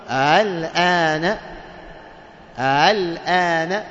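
"الآن" drawn out slowly, second hamza softened; eased hamza. Shown as a demonstration. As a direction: slide carefully through الآن with no second hard stop. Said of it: "التسهيل هذا". ("الآن" بالتسهيل، ببطء)

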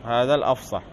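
normal talking voice

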